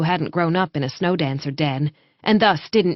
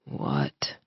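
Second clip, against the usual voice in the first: a much lower, quieter growly rumble; 9 dB quieter than usual. Acted. Sleepy wolf-man grumbling at his mate, low rumble. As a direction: rumble it half asleep like a wolf. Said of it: "It was a growly rumble".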